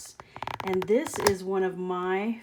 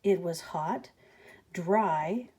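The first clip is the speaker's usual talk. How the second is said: usual speaking voice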